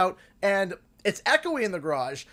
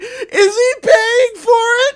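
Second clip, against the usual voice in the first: eager calling out; 12 semitones higher, 9 dB louder, slower than usual. Retold or imitated. Curious drivers calling out.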